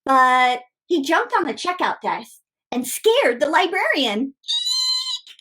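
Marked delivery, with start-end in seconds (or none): 4.2-5.3 high squeal. Acted. Librarian scared by frog.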